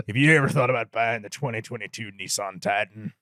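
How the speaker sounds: deep, low ad-man rumble; deep Sam Elliott impression. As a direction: sell the truck in a very low cowboy voice. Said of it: "people doing the Sam Elliott impression".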